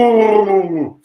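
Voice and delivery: a high, loud startled whoa; big shout. Retold or imitated. The hard man in the pub shouts a loud, high whoa. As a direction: bellow it like a giant stopped short. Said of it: "he just went".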